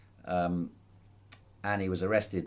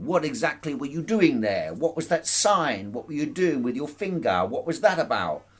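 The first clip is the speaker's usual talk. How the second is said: louder, higher, hammering accusing questions; harassing prosecutor; 8 semitones higher, 7 dB louder than usual. Retold or imitated. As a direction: grill him like a hostile prosecutor. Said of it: "really, really harassing the guy".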